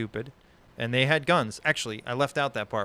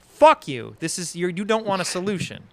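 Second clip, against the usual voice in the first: angry outburst; 7 semitones higher, 7 dB louder than usual. Retold or imitated. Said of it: "it's just like".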